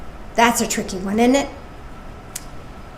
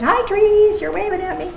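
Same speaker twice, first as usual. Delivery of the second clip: bright sing-song hello to the trees, higher; playful high greeting; 8 semitones higher than usual. Pretend. Speaker greeting waving trees in her window, playful high voice. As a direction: coo at the trees like a child would.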